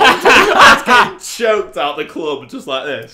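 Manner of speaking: slow, loud laughing retelling. Real, not acted. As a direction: laugh through the story. Laughs retelling choke hold.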